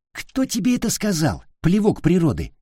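high-pitched indignant challenge. A narrator's reading. Pavlik challenges a rival fan, indignant. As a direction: demand it in outraged boyish protest. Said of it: "возмутился Павлик".

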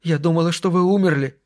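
high voice, holding back tears; high-pitched, understated tearfulness; suppressed sob. Acted. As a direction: say it as if stifling a sob. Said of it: "содрогнулось от подавленного рыдания".

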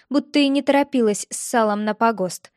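usual speaking voice

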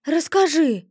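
curious ghost begging for details; eager plea. A narrator's reading. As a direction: beg for the story. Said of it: "от любопытства аж глаза загорелись".

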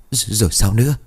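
stuttering, shaken whisper of fear; barely getting the words out; frightened stammer. Acted. Mother hears of the grave, frightened stammer.

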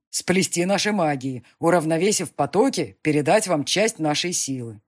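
loud, imperious demon lord. Acted. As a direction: defend his race firmly. Demon speech.

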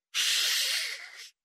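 whispered hiss; hissing shush. A narrator's reading. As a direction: shush them sharply. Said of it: "зашипел Питер".

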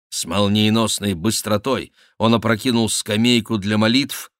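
ordinary delivery, nothing marked

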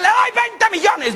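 high, slow call from a crowd; shouted bid. Acted. Man in crowd bids for the cow, calling out. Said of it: "Gritó un man".

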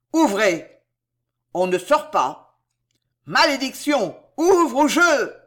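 loud, rising urgent shouts at the gate; hoarse short cry. A narrator's reading. Pardaillan shouting at the officer to open, loud cry. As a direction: bark orders to open up. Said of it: "Un cri rauque, bref".

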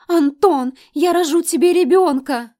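high piercing fan scream. A narrator's reading. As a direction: scream it at the star. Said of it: "И тут же завопила".

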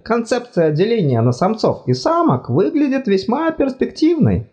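high fussy god voice, apologetic; embarrassed god. Acted. God speaks.

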